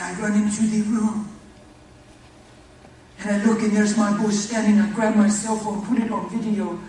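loud, high joyful rush; excited retelling. Retold or imitated. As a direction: tell it like the best news of his life. Father retells son's first steps.

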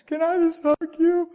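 voicing his trembling son; son's plea.

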